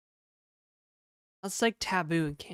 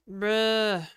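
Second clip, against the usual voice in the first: exasperated bruh; 4 semitones higher, 7 dB louder than usual. Real, not acted. Reacting to game.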